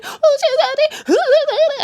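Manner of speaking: very high, loud theatrical bawling; hammed-up sobbing lament; mock crying. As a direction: wail it like a showy mourner. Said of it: "She starts crying".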